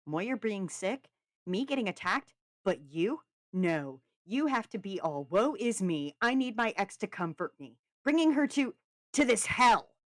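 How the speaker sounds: loud, mounting accusations; angry tirade. A narrator's reading. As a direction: berate him, building to the last word. Callum berates Finn.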